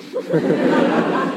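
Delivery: loud breathy laugh. Real, not acted. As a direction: crack up. Laughing at own joke.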